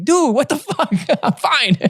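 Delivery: high, laughing protest; retold indignation breaking into a laugh; mock exasperation. Retold exasperated reply to phone.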